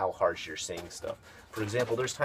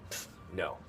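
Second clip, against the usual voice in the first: abrupt curt no; 4 semitones lower, 9 dB quieter than usual. Retold or imitated. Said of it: "it sounds very abrupt and very rude".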